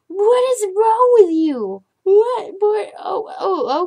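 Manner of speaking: high, loud, slow what is wrong with you; half-laughing alarm at a wild cat; scolding the cat hanging off her chair; exasperated cry. Real, not acted.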